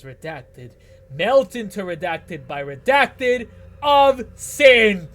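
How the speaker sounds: slow, high, booming incantation; loud theatrical prophecy; dramatic verse reading. Acted. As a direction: proclaim it grandly, stretching and swelling the key words.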